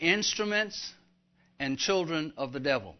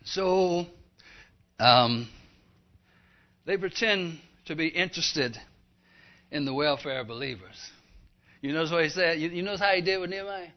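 usual speaking voice

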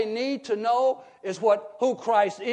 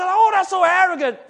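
scoffing critic; 8 semitones higher, 9 dB louder than usual. Retold or imitated. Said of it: "people say".

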